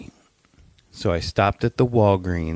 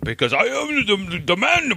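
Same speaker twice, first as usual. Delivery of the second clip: higher boasting voice; lightly voiced braggart; 11 semitones higher than usual. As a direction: puff it up like a proud knight. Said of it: "He's like".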